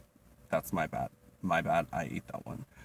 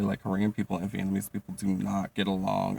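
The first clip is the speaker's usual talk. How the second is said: ordinary delivery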